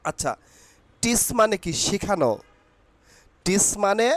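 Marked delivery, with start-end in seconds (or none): none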